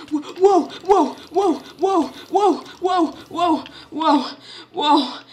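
high loud stunned wows; awed shouting. Real, not acted. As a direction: shout it out in awe.

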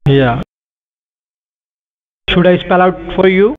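ordinary talk

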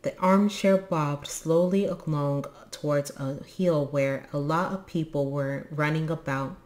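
usual speaking voice

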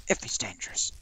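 hushed aside; whisper. Real, not acted. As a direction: whisper the condition.

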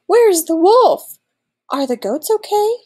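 high, alarmed questions; gasping. A narrator's reading. Villagers arriving to check the goats, gasping. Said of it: "the villagers gasped".

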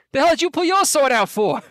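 high, loud goblin voice; loud, high-pitched goblin challenge. Acted. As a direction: yell it back as an indignant goblin. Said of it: "One of them's like".

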